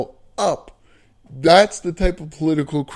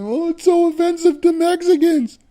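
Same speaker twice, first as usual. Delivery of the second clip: high, whiny mock voice, louder; mocking whiner; 14 semitones higher, 5 dB louder than usual. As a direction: parody the complainers with a shrill sneer. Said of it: "bitching and whining and saying".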